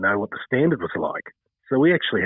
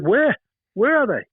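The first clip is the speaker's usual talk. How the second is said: much higher, louder startled Scouser voice; old Scouser; 12 semitones higher, 4 dB louder than usual. Retold or imitated. A Liverpool local looking for the missing cricketers, higher and louder. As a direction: pop up high like the puzzled old local. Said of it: "this Scouser hobbles over and says".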